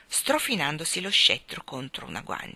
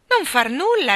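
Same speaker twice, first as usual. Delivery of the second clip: gleeful; 8 semitones higher, 9 dB louder than usual. Acted. King exclaims his answer.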